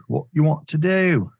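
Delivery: high, loud British accent put on for the market; coaxing the chart in a hammy British accent; mock British accent. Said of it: "my British accent comes out".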